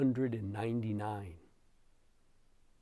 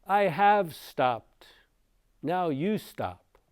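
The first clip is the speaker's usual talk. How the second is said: slow, raised, emphatic quote; voiced Buddha reply; 7 semitones higher, 6 dB louder, slower than usual. Buddha turning to Angulimala while walking, slow emphatic reply. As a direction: answer slowly and firmly, each word weighted. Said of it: "he turns around and he says".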